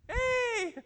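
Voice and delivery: high, drawn-out falsetto hey; a sing-song holler, kept light; mock cheery call. Bystander waving at teens on tubes, mock cheery call.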